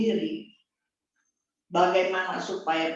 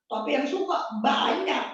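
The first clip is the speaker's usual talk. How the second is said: raised preaching shout; 6 semitones higher than usual. Acted.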